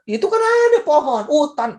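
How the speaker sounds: high, loud mock know-it-all; preacher mocking an ordinary man's view; ordinary person. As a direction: imitate a plain guy stating the obvious. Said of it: "Orangnya biasa".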